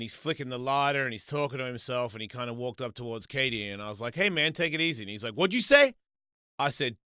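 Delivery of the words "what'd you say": loud, high confrontational question; retold aggressive comeback; acted challenge. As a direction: imitate someone spoiling for a fight. Stranger challenges him in retold quarrel.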